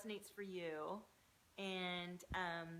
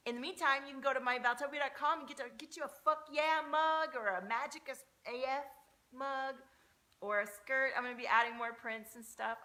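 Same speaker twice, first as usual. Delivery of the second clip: higher, louder, rushing sales pitch; peppy sales voice; 6 semitones higher, 8 dB louder than usual. Real, not acted. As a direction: hype up the merch like an ad. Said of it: "I don't know why I'm talking like this".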